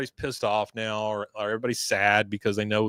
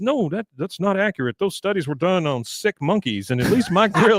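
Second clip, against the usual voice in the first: higher, fussy put-on voice; prissy clerk voice; 6 semitones higher than usual. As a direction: correct him primly. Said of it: "He goes".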